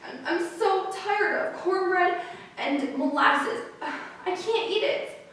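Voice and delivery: put-on high, drawn-out childlike lament; acted hungry child near tears; sobbing little sister. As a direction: plead tearfully, dragging out the words. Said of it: "dropped her little head right down and sobbed".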